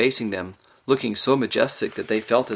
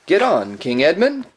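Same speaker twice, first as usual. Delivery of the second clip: higher, louder urging call; raised voice, urging him onward; calling out from behind; 7 semitones higher, 5 dB louder than usual. A narrator's reading. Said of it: "came Trumpkin's voice from behind and above".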